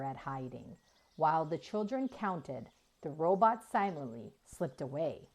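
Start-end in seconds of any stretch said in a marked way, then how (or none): none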